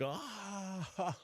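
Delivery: high, softened wail into laughter; imitated helpless laughter. Buddies crack up at the chalk marks, imitated high laughing. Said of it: "just start dying laughing".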